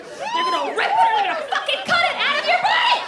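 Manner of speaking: high, loud panicked shriek. Retold or imitated. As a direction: shriek it in horror. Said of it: "you're like".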